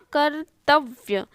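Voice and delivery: कर्तव्य split, "kar... tav... vya"; slow, separated parts in कर्तव्य; broken into parts. Shown as a demonstration. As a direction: dictate कर्तव्य piece by piece. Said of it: "तो वो होगा".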